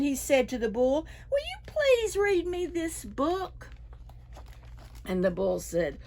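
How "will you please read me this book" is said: much higher, hopeful asking voice; pleading dragon. A narrator's reading. Dragon begging the raccoon to read, high and hopeful. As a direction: ask sweetly, hoping for yes.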